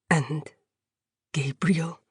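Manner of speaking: a hushed, soft question; a wounded prisoner whispering his question; whispered. A narrator's reading. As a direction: ask it barely above a breath. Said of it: "he whispered".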